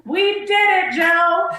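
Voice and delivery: high, loud triumphant call; mock victory cry. Parodying a victory speech to her cohost, high and loud. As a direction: cheer the win to a running mate.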